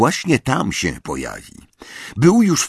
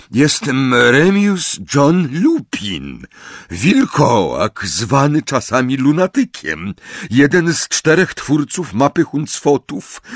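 Lupin, steady and formal; 7 semitones higher, 4 dB louder than usual. A narrator's reading. Lupin proving his identity.

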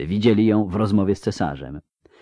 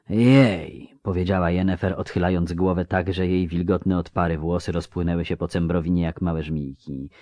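ordinary delivery, nothing marked

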